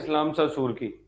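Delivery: ordinary talk, nothing marked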